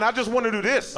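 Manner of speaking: ordinary talk, nothing marked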